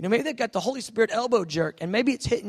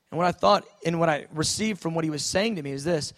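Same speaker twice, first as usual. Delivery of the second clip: usual speaking voice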